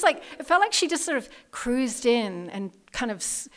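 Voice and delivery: ordinary delivery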